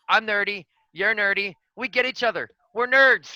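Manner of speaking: high, rising to a loud cheer; excited. Pretend. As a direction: cheer it out like a rally. Candidate hyping his anagram letters.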